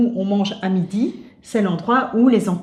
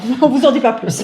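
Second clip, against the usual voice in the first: laughing sign-off; 5 semitones higher, 5 dB louder, faster than usual. Real, not acted.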